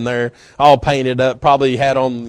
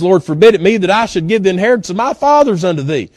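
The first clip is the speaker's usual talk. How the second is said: quick emphatic refusal; 4 semitones higher, 5 dB louder, faster than usual. Retold or imitated. Preacher quotes Naboth's refusal.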